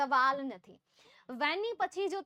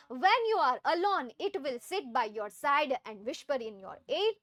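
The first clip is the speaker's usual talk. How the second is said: slow poem recitation; 5 dB louder, much slower than usual. Acted. Teacher reading the poem to her class, slow recitation. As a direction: read the poem line slowly and deliberately.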